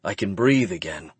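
usual speaking voice

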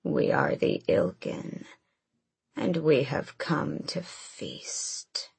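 very low slow demonic growl; deep monster voice. A narrator's reading. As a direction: menace them from the depths. Ilkin creature announces itself.

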